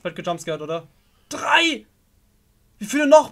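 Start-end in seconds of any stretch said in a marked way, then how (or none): 1.3-1.9 tense shout. Real, not acted. Counting under pressure. 2.8-3.3 panicked. Real, not acted. Panicking in horror game.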